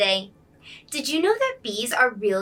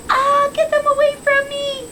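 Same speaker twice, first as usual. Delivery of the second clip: high, loud panicked cry; scared shriek; 8 dB louder than usual. Acted. The bee-hating boy shrieks to get bees away in panic. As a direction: squeal in fright at the bees.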